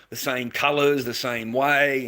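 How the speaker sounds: loud, slow words stressing "same"; emphatic. Real, not acted. Telling viewers how he copies clowns, emphatic. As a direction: lean hard on "the same way". Stressing painting masks identically.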